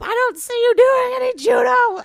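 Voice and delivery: high, loud imitation of a scolding mother; mock yell of a nagging mom. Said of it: "she yelled at me".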